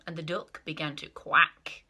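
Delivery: ordinary delivery, nothing marked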